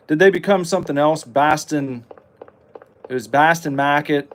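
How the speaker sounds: normal talking voice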